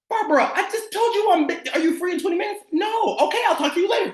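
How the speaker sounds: high, insistent mock phone argument; acted exasperation. Coach re-asks busy Barbara then hangs up, mock exasperated. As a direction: cut the caller off in a high, forceful voice. Acted repeat call, cutting her off.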